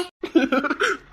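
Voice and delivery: high laugh; delighted laugh. Acted. Suitor delighted by her compliment, high laugh.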